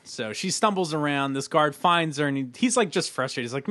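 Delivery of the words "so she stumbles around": usual speaking voice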